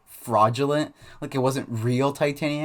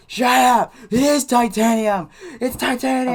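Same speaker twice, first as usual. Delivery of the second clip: high, loud tantrum shriek; mock screaming fit; 11 semitones higher, 7 dB louder than usual. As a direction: yell it like a kid losing it. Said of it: "he's having this huge fit".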